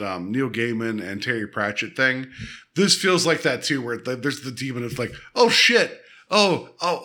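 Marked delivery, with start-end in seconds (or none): 5.4-7.0 high, louder startled realization; mock alarm. Demon realizing he is scaring her, mock alarm. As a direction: imitate a demon caught off guard.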